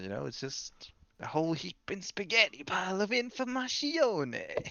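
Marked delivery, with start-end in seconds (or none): 1.2-4.7 high, slow, hammed-up Italian; drawn-out Italian caricature; mock Italian accent. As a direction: put on a bad Italian accent and stretch it out. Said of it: "that's not an Italian accent".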